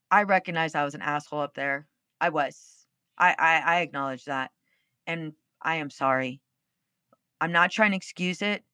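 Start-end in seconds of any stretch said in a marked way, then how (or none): 0.1-6.4 sincere apology. Retold or imitated. Apologizing to husband.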